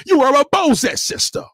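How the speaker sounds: high, loud proclamation; loud declaration. Acted. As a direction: shout the verdict at her. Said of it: "declaring the name".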